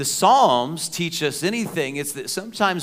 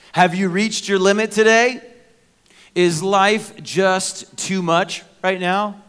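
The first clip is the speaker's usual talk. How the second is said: preaching appeal; 4 semitones higher, 5 dB louder than usual. Acted. Appeal to the congregation.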